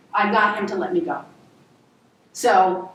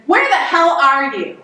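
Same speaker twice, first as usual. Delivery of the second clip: raised, higher demand; imitated angry mother; 5 semitones higher, 5 dB louder than usual. Mother confronting her child, imitated angry outburst. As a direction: demand it loudly as an exasperated mom.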